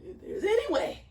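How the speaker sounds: high, loud, begging plea; pleading prayer. Retold or imitated. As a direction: beg God to take the cup away. Jesus pleading in the garden.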